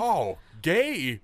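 high, loud drawn-out jeer; hammed-up high hoot; mock heckling. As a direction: tease him in a silly voice. Teasing heckle of friend's story.

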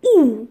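"oo" as one firm, loud vowel; French OU sound. Shown as a demonstration. Teacher reading the sound list to learners, clear oo. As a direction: say "oo" on its own, falling. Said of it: "O U is pronounced".

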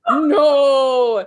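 loud anguished cry; dying scream. Acted. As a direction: scream as you're stabbed. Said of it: "Santa screamed".

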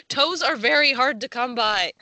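high, loud mock lament; loud dramatic. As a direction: belt out the complaint. Dramatic reading of quote.

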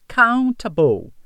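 "Countable" in a British accent, with a clear T sound; British pronunciation. Shown as a demonstration. Teacher models the British "Countable" for students, slow British accent. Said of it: "A pronúncia mais britânica fica countable".

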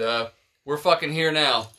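ordinary talk, nothing marked

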